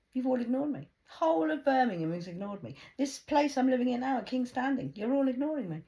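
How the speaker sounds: high, loud outraged shouting; angry shout. Real, not acted. Woman yelling at Birmingham for ignoring her, furious shout. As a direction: yell it at the whole city. Ranting at the city.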